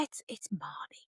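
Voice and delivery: soft whisper, slow; whispered name. Retold or imitated. Teen daughter whispering her name down the phone. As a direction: breathe the name. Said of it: "they're like".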